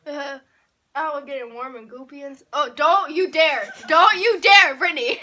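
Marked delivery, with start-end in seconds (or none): 2.5-5.2 laughing warning. Real, not acted. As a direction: warn her through laughter. Warning a friend with a mouthful.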